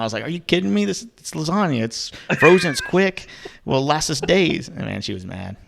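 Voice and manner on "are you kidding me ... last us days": higher, incredulous retold argument; arguing back. Retelling his side of the parking lot fight, higher and incredulous. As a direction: defend the lasagna like it's obvious. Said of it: "we were fighting in the parking lot".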